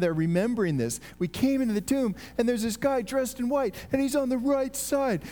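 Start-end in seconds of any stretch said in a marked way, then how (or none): 1.2-5.2 higher-pitched, breathless-to-tell retelling; excited eyewitness. Acted. Women telling what they saw in the tomb, excitedly. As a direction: relive it as an astonished witness, pitched up.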